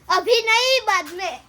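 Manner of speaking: loud, high-pitched shout; loud on request. Shown as a demonstration. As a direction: shout it out as told. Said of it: "જોરથી બોલ". (જોરથી, ઊંચા અવાજે)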